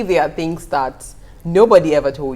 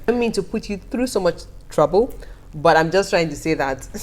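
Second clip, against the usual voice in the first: ordinary delivery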